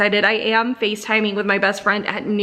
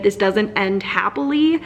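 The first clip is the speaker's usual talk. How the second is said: ordinary talk, nothing marked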